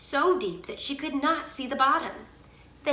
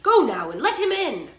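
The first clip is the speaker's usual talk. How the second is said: louder, higher royal order; stern king; 5 semitones higher, 7 dB louder than usual. A narrator's reading. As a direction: command it firmly as a king. King orders his daughter.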